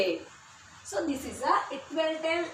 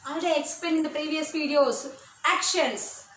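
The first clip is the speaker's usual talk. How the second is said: normal talking voice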